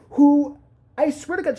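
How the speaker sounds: ordinary talk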